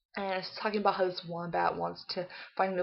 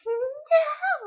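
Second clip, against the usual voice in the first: put-on high, loud toddler protest; mock tantrum; 9 dB louder than usual. Child refusing the bedcovers, mock shrieked protest.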